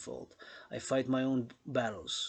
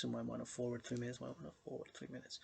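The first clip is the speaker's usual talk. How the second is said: quick, much softer echo that fades away; fading echo; 11 dB quieter, faster than usual. Acted. Echoing the skip advice to viewers, fast and fading. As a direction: let the line die out like an echo.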